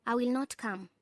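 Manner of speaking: final statement, pitch falling through to "come"; falling intonation. Shown as a demonstration. Narrator demonstrates a statement of finality, with falling intonation on "come". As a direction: let "come" drop with finality. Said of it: "Statements expressing finality".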